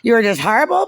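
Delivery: high, loud mocking accusation; Rhonda. Imagining Rhonda berating the speaker in the meeting, mock scolding. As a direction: mimic a scolding boss, swooping up high. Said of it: "Rhonda's like".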